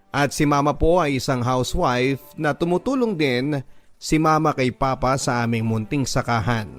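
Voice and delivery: ordinary delivery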